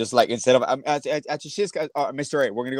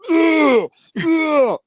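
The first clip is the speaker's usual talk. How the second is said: high, loud mock groan; pained groan; 14 semitones higher, 6 dB louder than usual. Imagining him reading the sick-house text, mock groan. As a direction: groan in exaggerated dismay.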